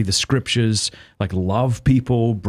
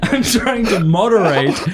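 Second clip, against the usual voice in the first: laughing; 7 semitones higher, slower than usual. Real, not acted. Laughs at catching himself.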